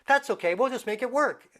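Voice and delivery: high, lifted, can-do voice; upbeat child's resolve. Retold or imitated. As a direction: imitate a cheerful kid shrugging off a mishap.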